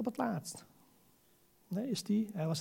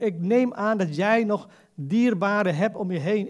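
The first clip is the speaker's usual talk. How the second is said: ordinary talk, nothing marked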